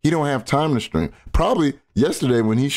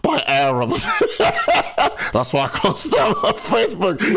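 high, cracking up through the words; giggling mock quote of the clip; laughing while quoting; 7 semitones higher than usual. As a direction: repeat the line while losing it laughing.

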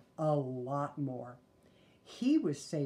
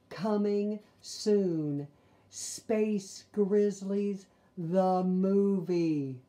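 slow, loud movie-trailer announcer; big coming-soon announcement; 5 semitones higher, 8 dB louder, much slower than usual. Pretend. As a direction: boom it like a trailer voice.

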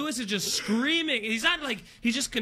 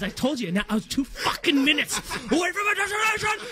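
mock rage building into a high scream; 5 semitones higher than usual. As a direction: start seething, erupt into imitated screaming. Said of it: "he gets screaming".

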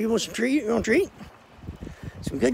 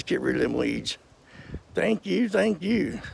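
usual speaking voice

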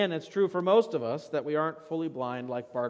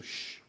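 soft drawn-out shh; irritated shush; 11 dB quieter than usual. Acted. Irritated crowd to the crying beggar, rebuking shush. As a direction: hush someone with a sharp shh. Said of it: "The crowd, irritated by your sudden outburst, rebukes you".

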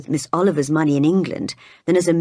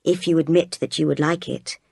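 plain reading voice

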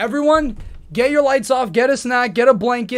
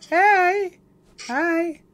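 high, sing-out sweet hello; high-pitched coaxing greeting; cutesy greeting; 10 semitones higher, 6 dB louder than usual. Real, not acted. Cheering up upset partner on call.

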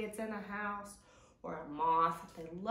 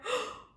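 mock gasp; 13 semitones higher than usual. Scare in the song.

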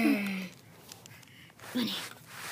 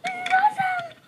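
squealing excitement; 14 semitones higher, 6 dB quieter, faster than usual. Acted. Thrilled at gift island.

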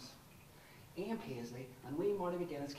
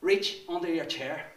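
louder, higher, pressing order; insistent command; 5 semitones higher, 9 dB louder than usual. Acted. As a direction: push him to do it.

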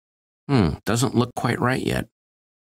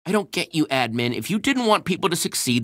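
ordinary talk

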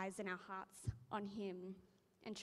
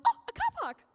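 much higher, loud squeal of delight at finding a spot; delighted surprise; 12 dB louder, faster than usual. Retold or imitated. Driver spotting a free car park, high delighted squeal. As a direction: shriek with glee at the empty spot. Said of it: "I'm like".

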